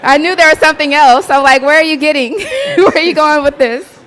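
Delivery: high, loud laughing disbelief. Real, not acted. Presenter laughing at the questioner's setup. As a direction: laugh it out, caught off guard.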